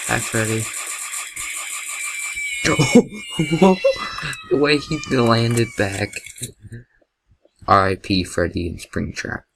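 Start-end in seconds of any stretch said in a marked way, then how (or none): 0.7-4.5 high, wheezy laughter building up; real laughing fit. Real, not acted. Gamer cracking up at how Freddy landed, real laughter. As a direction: burst into helpless high laughter.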